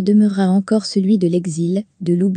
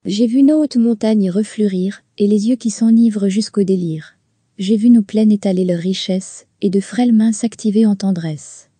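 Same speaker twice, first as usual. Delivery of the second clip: measured, earnest reading of verse; lightly declaimed poem; poem recitation. Acted. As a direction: recite each line like a heartfelt hymn.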